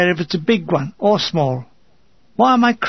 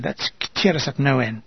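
low, deadpan irony; understated, gloomy sarcasm; dry sarcasm; 5 semitones lower, 4 dB quieter than usual. A narrator's reading. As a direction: drop the voice for a wry aside. Wry reply to grim advice.

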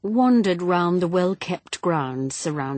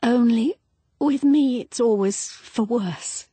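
higher, louder, voice catching on the words; catch in her voice; 9 semitones higher, 4 dB louder than usual. A narrator's reading. Pat confesses her bad luck to Lance, voice catching. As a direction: let the voice crack with sudden grief. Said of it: "with a sudden catch in her voice".

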